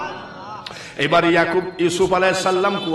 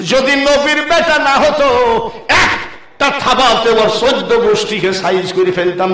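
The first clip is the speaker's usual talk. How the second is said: loud, raised, stretched opening; forceful threat; 4 semitones higher, 6 dB louder than usual. Acted. Tiger complaining to Allah about the prophet's sons, forceful threat. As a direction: push it out hard like a held-back threat.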